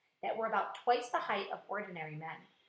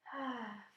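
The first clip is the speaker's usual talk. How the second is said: tired sigh; 8 dB quieter than usual. A narrator's reading. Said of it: "The silkworm sighed".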